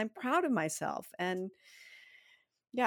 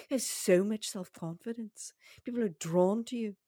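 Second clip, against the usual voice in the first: playfully imitated accented meditation guide; possibly South African accented recording voice; hypnotist's foreign accent. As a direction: stretch out the affirmations in a hypnotist's accent. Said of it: "I don't know what that accent is".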